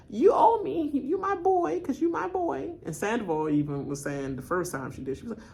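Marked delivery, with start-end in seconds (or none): 0.1-2.7 high mock pleading voice, much higher; imitating Danielle. Mocking a contestant's plea to her ally, in a high imitating voice. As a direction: mimic her begging her boy. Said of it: "and said".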